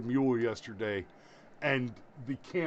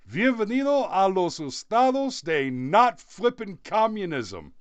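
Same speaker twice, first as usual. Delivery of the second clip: higher and louder, a cheeky Spanish welcome; playful Spanish greeting; 5 semitones higher, 5 dB louder than usual. Retold or imitated. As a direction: sell the joke with a bright burst. Joke greeting to the candidate.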